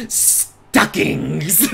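drawn-out mock alert cry; mimicked message alert. Streamer imitating a message breaking into romance, mock alert. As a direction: stretch it out like a silly interjection. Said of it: "suddenly messages".

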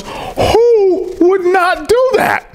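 imitating a very high, long-drawn cry of amazement; incredulous exclamation. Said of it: "That's how he talks".

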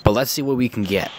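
ordinary delivery

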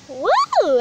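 a loud, high whoa of wonder; delighted amazement. Real, not acted. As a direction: gasp in delight at the bug. Kid sees stick bug.

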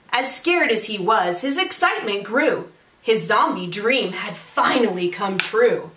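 lively, singsong storytelling; picture-book read-aloud. A narrator's reading. Teacher reads a zombie picture book to families, animated read-aloud. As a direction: read it to children with rhythmic emphasis.

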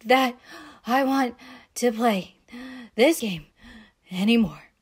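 broken up by gasps, a few words per breath; winded, halting child; gasping for air. A narrator's reading. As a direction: pant between words after fleeing. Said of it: "in between gasps of air".

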